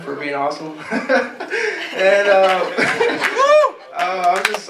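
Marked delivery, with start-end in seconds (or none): 0.8-1.9 bursting into a high, genuine laugh; real laugh. Real, not acted. Graduate laughs during thank-yous. 2.6-3.9 high, loud laugh breaking through the speech; real laugh. Real, not acted. Graduate keeps laughing, embarrassed.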